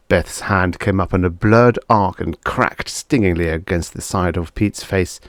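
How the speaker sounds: usual speaking voice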